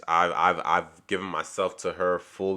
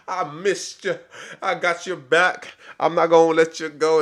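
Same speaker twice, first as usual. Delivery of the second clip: put-on much higher, tender swooning voice of a boyfriend; lovestruck boyfriend; 9 semitones higher, 5 dB louder than usual. James lifting Raya, imitated high and doting. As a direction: gush it like a smitten man sweeping her up.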